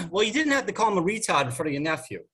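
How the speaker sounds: put-on high, fast Jersey accent; Janie. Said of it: "gonna try to do some Jersey accents".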